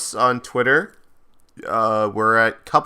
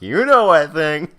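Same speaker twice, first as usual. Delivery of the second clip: slow, louder, insinuating retort; knowing tease; 5 semitones higher, 5 dB louder, slower than usual. Retold or imitated. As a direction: stretch it out with a high swoop on KNOW. Teasing retort to feigned ignorance.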